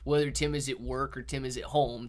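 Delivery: normal talking voice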